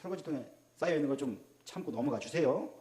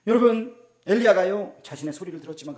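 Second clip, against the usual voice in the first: ordinary talk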